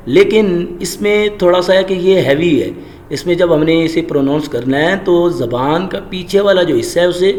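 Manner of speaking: ordinary talk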